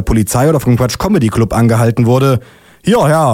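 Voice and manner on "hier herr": higher-pitched summons; calling out. Acted. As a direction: policeman hailing a cyclist. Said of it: "ruft der Polizist".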